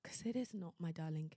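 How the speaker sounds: softly, like comforting a little sister; tender reassurance. Real, not acted.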